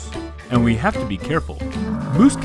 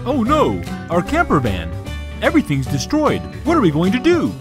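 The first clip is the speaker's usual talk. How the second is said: dismayed; 8 semitones higher than usual. Acted. Toy dad sees van destroyed.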